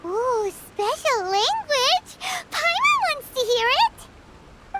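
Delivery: put-on childlike curiosity building to eager excitement. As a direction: ooh it out, then beg to hear it. Paimon eager to hear.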